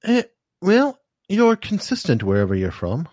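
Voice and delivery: high "Eh, well" in a mock British accent; British actor's remark. Retelling what the British co-star said, mild mock British accent. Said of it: "essentially said".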